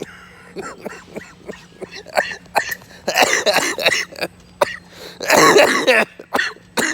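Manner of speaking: big loud cackle; loud cackling laugh. Real, not acted. Loud cackle at the media.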